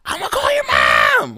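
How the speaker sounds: slow, very high mock shriek; losing it. Girlfriend panics over boyfriend's accident, losing it in a high shriek. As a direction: shriek it loudly like a frantic girlfriend. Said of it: "Losing her shit".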